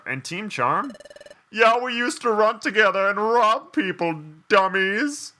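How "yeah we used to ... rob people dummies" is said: high, loud cartoon voice; cheeky game character. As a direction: pitch it up for a gleeful boast.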